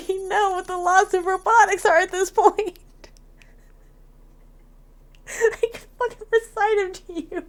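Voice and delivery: very high, squeaky laughing protest; high voice, breaking into giggles; exasperated laughter. Real, not acted. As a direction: protest helplessly through fits of laughter. Laughing exasperation at repeated laws.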